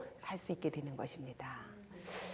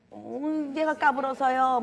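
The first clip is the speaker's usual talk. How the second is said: put-on whiny kid excuse; 6 semitones higher, 6 dB louder, slower than usual. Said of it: "뭐 하면서 변명하지요".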